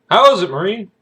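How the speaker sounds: put-on retired Marine hailing a young man; hearty Marine greeting. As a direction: call out like a proud old Marine. Former Marine greets narrator.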